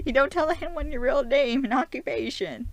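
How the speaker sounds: loud, high-pitched exasperated telling-off; mock scolding. Vlogger scolding Stephen over his real name, loud mock exasperation. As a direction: lecture him in over-the-top disbelief.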